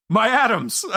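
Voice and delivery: high, hammed-up wail; imitated teacher's cry. Host repeating his favorite obscure movie line, mock-distraught cry. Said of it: "the way he just says it".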